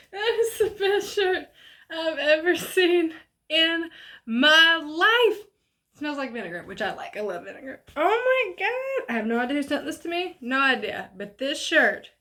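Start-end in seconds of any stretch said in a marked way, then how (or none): 0.1-5.5 high, slow, laughing and near tears; laughing with delight. Real, not acted. Vlogger thrilled by a mystery gift shirt, laughing high. 8.0-9.1 delighted oh my God. Real, not acted. Reacting to shirt.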